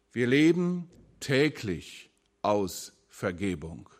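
ordinary talk, nothing marked

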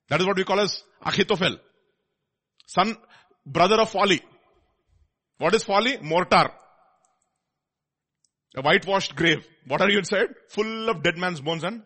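loud, rising preaching voice; forceful preaching. Real, not acted. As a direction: hammer it home from the pulpit.

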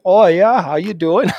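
put-on high, loud Midwestern accent; chirpy North Dakota accent, laughing. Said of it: "they respond with".

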